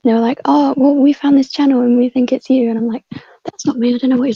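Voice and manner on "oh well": ordinary delivery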